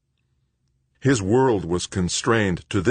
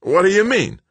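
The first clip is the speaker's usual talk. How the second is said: high, loud outraged shout; raging; 8 dB louder than usual. Acted. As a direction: explode at the clerk. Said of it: "Greg raged".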